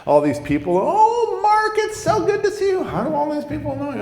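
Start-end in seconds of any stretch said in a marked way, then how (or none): 0.8-2.8 very high falsetto welcome; mock falsetto gushing; gushing church greeter. Church people greet him gushingly. 2.8-4.0 high falsetto mock girlfriend; imitated suspicious squeal; baffled girlfriend.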